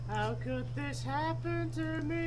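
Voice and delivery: very high, slow mock wail; Ninja. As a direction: wail it in hammed-up despair. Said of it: "where it's just going".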